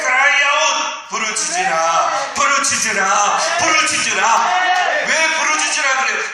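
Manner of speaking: loud, high shouted sermon; preaching at full force. Acted. Pastor urging the congregation to cry out, shouting high. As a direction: thunder it out to the congregation.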